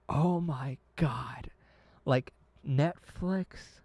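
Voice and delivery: slow whispered disbelief; slow stunned whisper; disbelieving whisper. Real, not acted. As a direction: whisper it like you can't believe it. Disbelief at Netflix.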